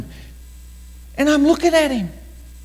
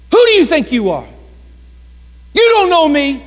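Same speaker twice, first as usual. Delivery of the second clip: retold shouting; 9 semitones higher, 4 dB louder than usual. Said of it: "I hear shouting".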